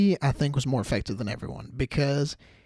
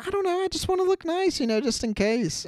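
put-on much higher, sing-song girlish voice; girlish sister. Narrator mimicking Allison's sister about makeup, high and girlish. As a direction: toss it off like a sister playing coy.

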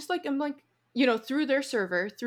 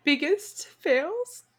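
dramatic; 6 semitones higher, much slower than usual. Real, not acted. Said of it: "I'm so dramatic".

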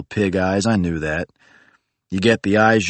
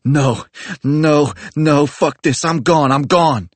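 much higher, louder panicked yelling; panicked screaming; 8 semitones higher, 4 dB louder, slower than usual. Retold or imitated. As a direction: scream it as you bolt. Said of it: "He started screaming again".

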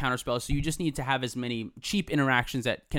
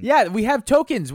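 ad pitch; 7 semitones higher, 7 dB louder, slower than usual. Pretend. Ad pitch announcing tokens.

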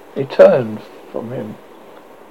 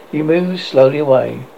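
ordinary delivery, nothing marked

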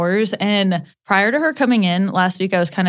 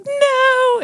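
stunned disbelief. Retold or imitated. Teacher hears boyfriend's name.

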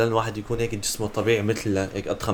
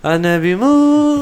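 high, slow drawn-out wail; mock dying wail; 13 semitones higher, 9 dB louder, much slower than usual. Dramatic joking despair.

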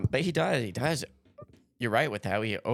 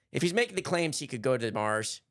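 ordinary talk, nothing marked

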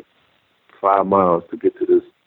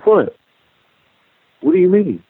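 high, startled what; imitating a friend caught off guard, pitch jumping up; higher, louder incredulous what do you mean; stunned disbelief; 11 semitones higher, 3 dB louder than usual. Friend stunned by his reply.

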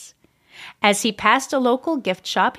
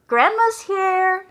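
slow, high call up the stairs; calling out from downstairs, drawn out; shouted call; 9 semitones higher, slower than usual. A narrator's reading. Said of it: "shouted his mom".

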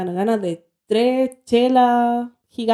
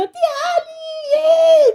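put-on high-pitched squeal of a child's greeting; mock childlike cheer, voice leaping up; excited nephew; much slower than usual. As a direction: imitate an overjoyed little nephew. Excited nephew runs out to greet her.

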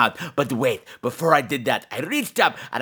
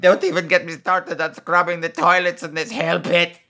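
gargoyle character voice; 3 semitones higher than usual. Acted. Podcast host voicing a gargoyle character.

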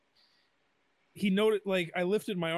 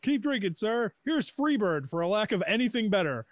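wry performer; 6 semitones higher, 5 dB louder than usual. Retold or imitated. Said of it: "he looked at me and said".

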